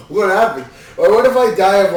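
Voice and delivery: ordinary talk, nothing marked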